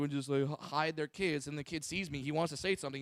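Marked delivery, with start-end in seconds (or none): none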